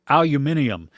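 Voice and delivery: Aluminium with five syllables, "al-yoo-MIN-ee-um"; British pronunciation of Aluminium. Shown as a demonstration. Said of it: "British add an extra letter".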